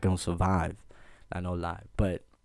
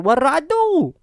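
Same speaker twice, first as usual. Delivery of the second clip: much higher and louder, squeaky confusion; baffled outburst; 8 dB louder than usual. Retold or imitated. Boy accused of harassment protests, high and baffled. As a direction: shoot up into a high disbelieving yelp. Quoting himself to principal.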